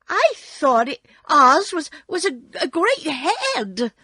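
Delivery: put-on high, loud, halting girl; girl's voice. A narrator's reading. Dorothy puzzled at the little man, high halting voice. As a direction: stumble over it in a high girl's voice. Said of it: "said Dorothy".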